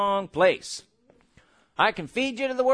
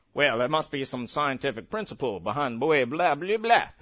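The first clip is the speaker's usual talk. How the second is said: mock skeptic; 9 semitones lower, 4 dB quieter than usual. Mocking the doubting head's voice.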